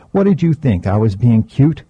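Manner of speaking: ordinary delivery, nothing marked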